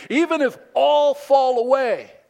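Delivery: loud, high declaration; Peter. Acted. Peter declaring his loyalty to Jesus before the others, loud declaration. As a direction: shout it out for everybody to hear. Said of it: "Peter declared".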